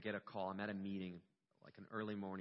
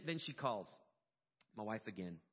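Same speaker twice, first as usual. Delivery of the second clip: ordinary talk, nothing marked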